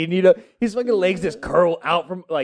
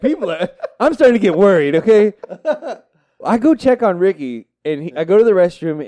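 ordinary delivery

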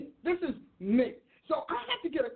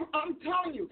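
normal talking voice

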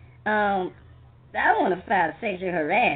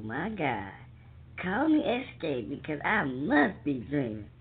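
ordinary talk

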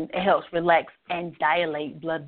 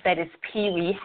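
ordinary talk, nothing marked

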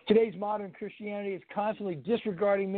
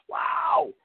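a much higher, put-on "Wow!"; exclaimed wow; 9 semitones higher than usual. Shown as a demonstration. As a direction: toss in a big amazed "Wow!" for effect. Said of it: "I threw that in there for accent".

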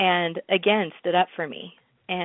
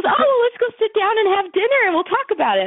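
high and louder, laughing through the producer's invitation; laughing retelling; 6 dB louder than usual. Retold or imitated. Scene: retelling the producer inviting her to dinner, laughing. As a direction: quote him while cracking up. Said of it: "And he goes".

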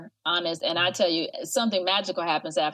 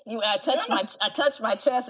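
ordinary talk, nothing marked

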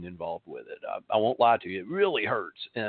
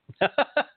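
laugh; 4 dB louder than usual. Real, not acted. Laughs at own point.

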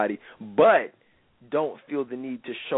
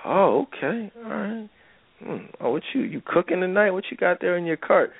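lingering flirt; 6 semitones higher than usual. Retold or imitated. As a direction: keep her pitched up and coy.

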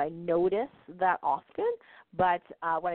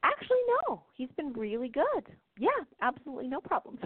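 much higher, relieved answer; patient to healer, high relieved; imitating relieved patient; 9 semitones higher than usual. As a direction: report good news brightly. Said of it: "She says".

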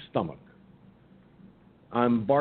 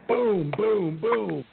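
imitated door-pounding, more insistent; mock knocking; 9 semitones higher, much slower than usual. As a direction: pound it out harder this time.

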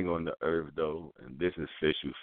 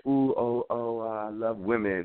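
put-on higher, slow, gushing girly voice; hammed-up feminine gushing; mock girly female; 4 semitones higher, slower than usual. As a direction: put on a swooning female, drawn out. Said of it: "You can't be a female".

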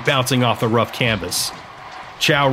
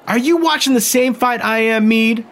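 much higher, pointed female demand; Emmeline confronting Meade between rounds, demanding; demanding woman; 10 semitones higher than usual. Acted. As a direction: challenge him, incredulous. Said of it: "Emmeline demanded".

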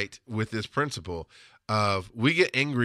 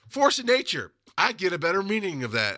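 normal talking voice

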